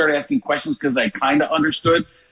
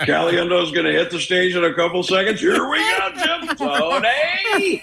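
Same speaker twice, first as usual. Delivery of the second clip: announcer hype climbing much higher, laughing; Nantz impression; 4 semitones higher than usual. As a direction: build like a broadcaster calling the entrance. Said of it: "get Nantz out and go".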